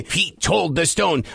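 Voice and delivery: ordinary delivery, nothing marked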